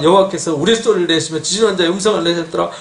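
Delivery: ordinary talk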